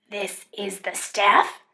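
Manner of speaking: normal talking voice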